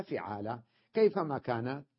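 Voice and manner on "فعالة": فعالة said as fiʕala, a short i after the f, a bit lower and softer; kasra on the fa. Shown as a demonstration. Teacher drilling the vowel for students, first syllable fi- softly. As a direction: say فعالة with a clear fi- sound. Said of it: "بتثليث الفاء".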